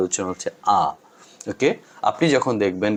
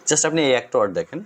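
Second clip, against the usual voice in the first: ordinary talk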